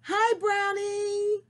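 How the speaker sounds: plain reading voice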